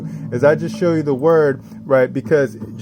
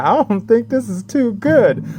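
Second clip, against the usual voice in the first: mock worried voice; 10 semitones higher than usual. Imagined doubtful reaction quote.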